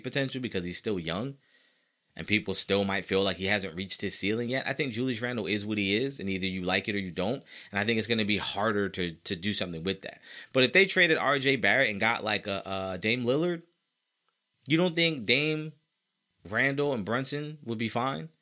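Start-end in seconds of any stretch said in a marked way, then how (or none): none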